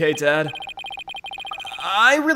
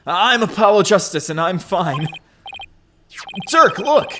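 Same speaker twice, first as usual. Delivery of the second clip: ordinary talk, nothing marked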